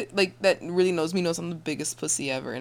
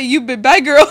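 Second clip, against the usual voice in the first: loud laughing broken-English line; laughing as the silly Papa; 6 semitones higher, 14 dB louder than usual. Pretend. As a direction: crack up mid-line. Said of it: "Papa say".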